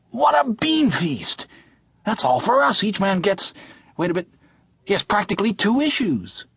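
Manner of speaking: high, gloating glee over a feast; gleeful. Acted. Tjaden gleeful over the food.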